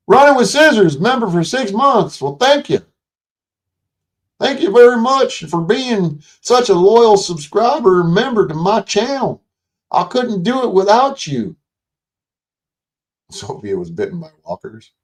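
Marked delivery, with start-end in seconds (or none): 0.1-11.6 much higher, put-on squeaky voice for the thanks; high, playful mock-sweet gratitude; squeaky thank-you voice. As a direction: pipe up like a cartoon character to say thanks. High voice while thanking a member.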